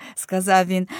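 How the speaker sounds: ordinary delivery, nothing marked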